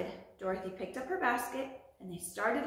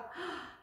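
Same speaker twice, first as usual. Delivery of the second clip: gasp; 7 semitones higher, 7 dB quieter than usual. Acted. Storyteller gasps mid-line.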